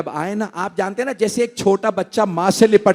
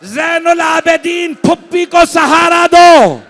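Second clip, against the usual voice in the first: high, loud, drawn-out majlis recitation; preaching at full force; 5 semitones higher, 3 dB louder than usual. Acted. Preacher to mourners, high chanted preaching. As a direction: lift the mourners with a wailing tune. Majlis peak, shouting lament.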